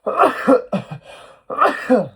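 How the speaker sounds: loud sudden sneeze; sneezing. Real, not acted. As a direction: let a real sneeze burst out. Excuse me, bless me.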